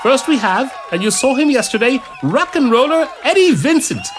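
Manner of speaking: very high, loud showman's introduction; stage announcer. Acted. Priest introduces first act to the crowd, loud announcer. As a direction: build to the big name.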